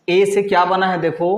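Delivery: normal talking voice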